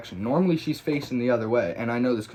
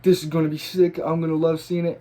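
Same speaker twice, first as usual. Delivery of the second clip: higher, louder excited self-talk; eager anticipation; 6 semitones higher, 5 dB louder than usual. Retold or imitated. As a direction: act out being thrilled to see it. Retold eager anticipation of his tag.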